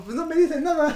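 put-on high, sheepish little kid voice; sheepish schoolboy. Boy answering the principal about wrestling shows, high and sheepish. Voicing his child self to principal.

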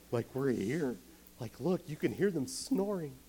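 an overexcited soldier barely holding it in; overeager soldier. Retold or imitated. Soldier hyped before the night attack, eager and lifted. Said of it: "way too excited to be there".

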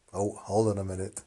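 ordinary delivery

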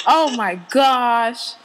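normal talking voice

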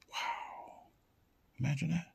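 long, hushed, breath-only wow; whispered amazement; awed whisper. Real, not acted. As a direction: let it out softly in wonder. Awed at what is imagined.

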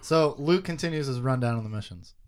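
ordinary delivery

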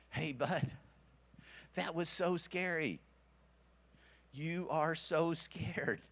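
gently reassure, stretching the words out; comforting a scared child. Retold or imitated. Father comforting scared son.

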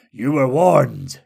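slow, forceful warning; dark warning. A narrator's reading. Wizard Jihur answers the fleeing Skurgs, dark warning. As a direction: deliver the warning heavily and slowly. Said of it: "detected a sense of darkness in his voice".

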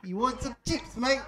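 high, exaggerated British voice; mock British accent. Showing friend how the British talk, mock British accent. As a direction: ham up British speech, pitched high. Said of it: "They go".

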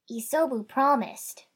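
a hint of a young voice; young-sounding beast. A narrator's reading. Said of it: "still sounding surprisingly young".

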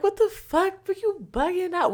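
high-pitched angry yell; high loud yell. Retold or imitated. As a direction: yell at the driver. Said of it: "We like".

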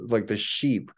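ordinary delivery

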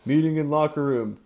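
loud, high booming call through a door. A narrator's reading. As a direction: boom it out from outside. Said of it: "the muffled voice boomed".

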